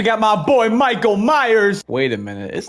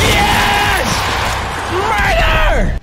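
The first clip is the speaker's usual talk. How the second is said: triumphant shouts; 11 semitones higher, much slower than usual. Real, not acted. Celebrating a kill.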